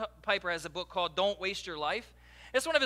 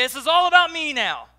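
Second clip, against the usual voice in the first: mock selfish; 6 semitones higher, 13 dB louder than usual. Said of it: "and say".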